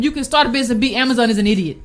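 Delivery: usual speaking voice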